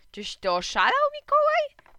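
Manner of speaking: loud, shrill high cry of disbelief; outraged shout. A narrator's reading. Mother shocked by son's restaurant idea, loud outraged shout.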